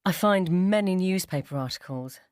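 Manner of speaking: plain reading voice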